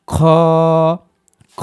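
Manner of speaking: খ rasped from the back of the throat; kha demo. Shown as a demonstration. Teacher models খ twice for learners. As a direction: scrape খ out from the end of the throat. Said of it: "কণ্ঠনালীর একবারে শেষের দিক থেকে".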